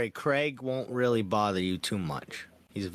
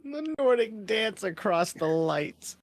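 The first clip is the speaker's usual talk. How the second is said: high, slow, drawn-out proclamation; grand mock-poetic echo; 7 semitones higher, slower than usual. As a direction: intone it like a lofty title. Grandly echoes friend's poetic phrase.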